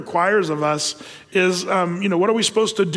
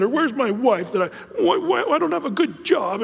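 mock whiny; 3 semitones higher than usual. Miserable person whining at God, hammed-up whiny and wimpy. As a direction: whine like a sulking child feeling sorry for itself. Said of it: "we get all, um, whiny and wimpy".

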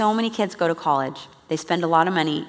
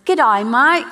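cheery Australian "G'day, mate", pitched higher and louder; Australian accent; 6 semitones higher, 7 dB louder than usual. Retold or imitated. Imitating an Australian couple's video greeting, cheerful Australian accent. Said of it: "they literally were".

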